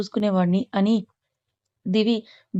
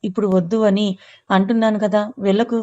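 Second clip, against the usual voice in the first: plain reading voice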